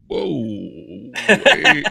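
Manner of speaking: drawn-out whoa, then high loud wait; mock alarm. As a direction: imitate someone suddenly alarmed, pitch shooting up. Mimicking gun supporters suddenly backtracking.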